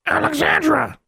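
very high, angry bird screech; angry raven squawk. A narrator's reading. Caged raven protesting to its owner, squawking angrily. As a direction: squawk the name furiously like a raven. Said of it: "squawked Charlie, angrily now".

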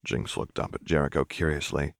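ordinary delivery, nothing marked

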